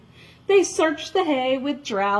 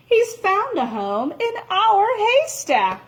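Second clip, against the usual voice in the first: higher, cheerful announcement; happy announcement; 10 semitones higher than usual. Acted. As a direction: tell them the good news gladly. Jack's happy decision.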